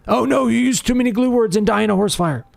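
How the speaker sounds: high-pitched whiny mockery; mock a fussy complainer in a high voice; silly high sing-song "oh no"; mock alarm. Mocking the writing tool's complaint.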